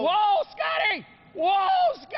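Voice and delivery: high, drawn-out excited shout; shouting at the sweeper. Real, not acted. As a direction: call him off loudly. Calling the sweeper off.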